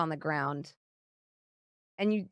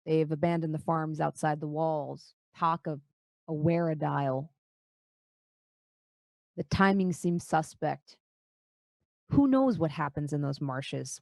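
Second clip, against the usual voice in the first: low, grave dwarf lord; 3 semitones lower than usual. Acted. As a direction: speak low and measured. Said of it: "he says".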